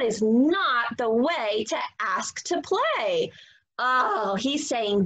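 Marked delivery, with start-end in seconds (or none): none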